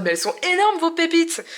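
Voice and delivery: high, indignant mock viewer. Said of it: "Vous étiez en mode".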